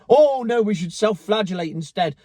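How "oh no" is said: usual speaking voice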